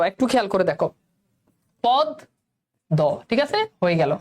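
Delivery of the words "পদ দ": "পদ", a gap, then "দ"; split syllables. Shown as a demonstration. Teacher spelling out the sound for students, পদ and দ apart. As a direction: break it in two, pod... do. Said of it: "অন্যটার উচ্চারণ দুইবার হচ্ছে".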